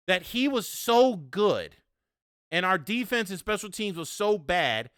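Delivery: ordinary delivery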